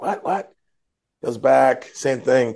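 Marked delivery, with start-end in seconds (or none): 0.0-0.5 startled what. Retold or imitated. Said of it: "He's like".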